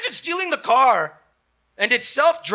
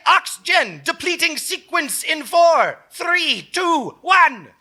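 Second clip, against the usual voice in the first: robot voice; 7 semitones higher than usual. Acted. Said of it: "and a voice saying".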